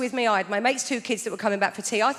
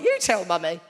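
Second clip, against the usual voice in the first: put-on sulky child; 5 semitones lower than usual. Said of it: "He's like".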